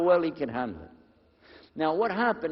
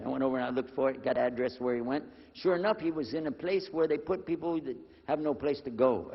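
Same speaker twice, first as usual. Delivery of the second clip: normal talking voice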